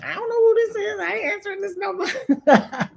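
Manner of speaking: very high squeaky put-on voice; mock suspicious caller-screener. Host imitates Kayla screening an unknown number, mock suspicious. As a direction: imitate her refusing a call, comically high.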